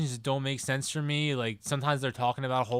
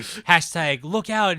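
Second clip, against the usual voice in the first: slow, louder hammed-up hashtag; corny; 4 semitones higher, 6 dB louder, much slower than usual. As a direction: read it like a cheesy post, stretched out. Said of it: "it's, like, really corny".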